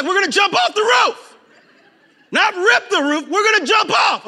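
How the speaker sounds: high, loud hyped-up yell; excited group shout. Acted. Friends announcing a roof jump, excited shouting. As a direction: shout it like friends daring each other.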